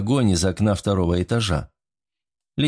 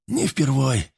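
higher, strained wounded voice; hoarse croak; 4 semitones higher, slower than usual. A narrator's reading. Wounded soldier reassuring his lieutenant, hoarsely. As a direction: croak it out through pain, mildly. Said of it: "прохрипел боец".

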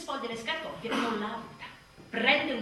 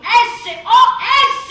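shouted SOS; 10 semitones higher, 18 dB louder, much slower than usual. Acted. Mother spelling out a cry for help, loud and laughing.

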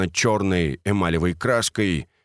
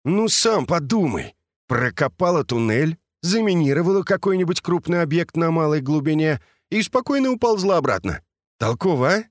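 eager; 8 semitones higher than usual. A narrator's reading. Misha explains eagerly.

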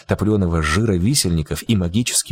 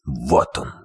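deep monster voice; 8 semitones lower than usual. Acted. Viy pointing at the screen.

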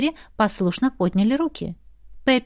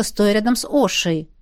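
ordinary delivery

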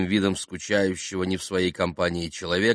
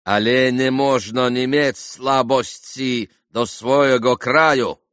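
loud, slow, higher-pitched protest; heated declaration; 5 semitones higher, 7 dB louder, slower than usual. A narrator's reading. Vrublevsky boiling over about his homeland, heated declaiming. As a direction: declaim with indignation. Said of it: "возгласил он".

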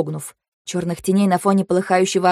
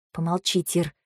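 very soft, low murmur; hushed, absorbed plea for silence; subdued request; 3 semitones lower, 9 dB quieter than usual. A narrator's reading. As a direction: ask quietly, preoccupied. Quiet request while thinking.

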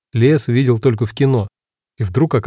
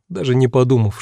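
ordinary delivery, nothing marked

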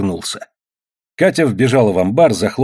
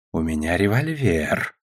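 low, slow menace; soft, drawn-out, lowered warning; threatening; 5 semitones lower, 5 dB quieter, slower than usual. A narrator's reading. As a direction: threaten quietly and deliberately. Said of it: "угрожающе повторил советник".

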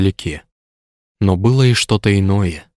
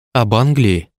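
surprised; 3 semitones higher, slower than usual. Acted. Surprised question in dialogue.

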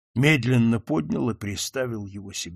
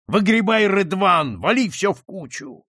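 loud barking command; shouted order; 8 semitones higher, 5 dB louder than usual. Acted. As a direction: yell the order across the road. Count orders robbers at night.

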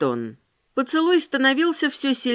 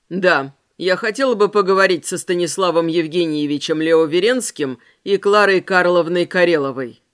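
lower man's voice; 5 semitones lower, 3 dB louder than usual. Acted. Ramirez voiced by narrator.

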